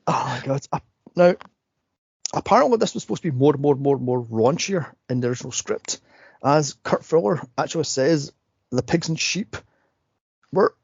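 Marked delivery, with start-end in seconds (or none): none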